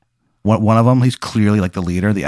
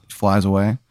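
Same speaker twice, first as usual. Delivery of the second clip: ordinary talk, nothing marked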